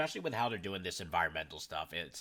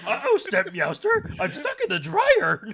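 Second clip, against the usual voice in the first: very high, loud, laughing cat squeak; squeaky cat voice; 13 dB louder than usual. Pretend. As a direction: whine it like a stuck cartoon cat. Playing Palico cat voice.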